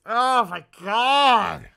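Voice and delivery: loud, slow, drawn-out disbelief; appalled exclamation. Real, not acted. Streamer sees toy prices, loud appalled disbelief. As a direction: stretch it out in horror.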